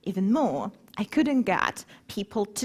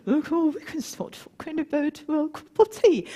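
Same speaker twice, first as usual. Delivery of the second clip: put-on light playful British accent, a bit higher; mock British accent; 6 semitones higher than usual. Speaker plays with accents for the audience, mock British accent. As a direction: toy with a posh English lilt on the tea. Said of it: "about accents".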